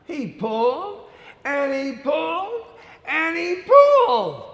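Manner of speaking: building strain. A narrator's reading. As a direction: build the effort on every pulled. Building pull repetition.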